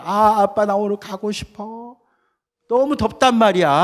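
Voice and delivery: high, loud whining plea to dad; whiny begging child. Acted. Child pleading with father to go swimming, whining loudly. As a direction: beg like a hot, impatient kid.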